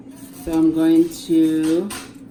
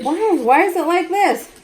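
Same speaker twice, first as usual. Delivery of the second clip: higher incredulous repeated question; mock despair at the pot; exasperated; 9 semitones higher, 4 dB louder than usual. Mother retelling to sister, incredulous. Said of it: "But I was like".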